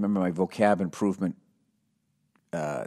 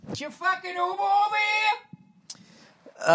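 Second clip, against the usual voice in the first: mock Boston guy; 4 dB quieter, slower than usual. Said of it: "the club owner".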